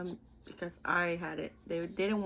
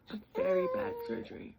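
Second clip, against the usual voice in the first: crying. Real, not acted. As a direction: voice cracking high into tears. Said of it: "he was like".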